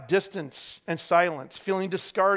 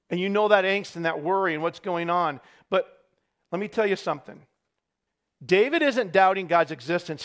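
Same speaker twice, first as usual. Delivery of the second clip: ordinary delivery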